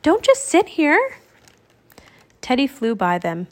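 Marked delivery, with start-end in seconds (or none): 0.0-1.2 high, eager urging; excited croak. A narrator's reading. Annie urging Jack off the ledge, excited croak. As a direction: nudge him on in a high voice. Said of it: "she croaked".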